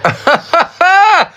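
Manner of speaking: bursting into a high laugh; amused high cackle. Real, not acted. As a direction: let a high laugh break out. Laughs at own proverb.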